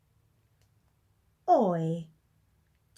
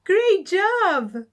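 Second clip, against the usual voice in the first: praise; 8 semitones higher, 10 dB louder than usual. Acted. Praising the class.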